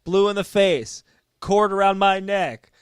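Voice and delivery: loud, slow wrestling proclamation; imitating intense promo. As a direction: build to a drawn-out, loud last line.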